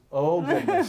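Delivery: laughing through a mock gasp; mock fright with a laugh; laughing mock alarm. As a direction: play Peter's sudden worry while cracking up. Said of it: "and then he remembers".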